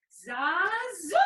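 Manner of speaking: Zazu called high and loud; rising high Zazu call; high bird call. Shown as a demonstration. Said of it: "Nice and high".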